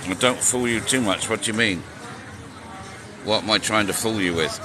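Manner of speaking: ordinary talk, nothing marked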